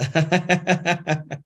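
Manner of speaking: a burst of real laughter; laughing. Real, not acted. Channeler amused by the beings, bursting into laughter. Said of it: "I was laughing because".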